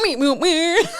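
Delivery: beeping robot noises; playful mechanical meeps. Pretend. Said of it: "I can do a robot voice".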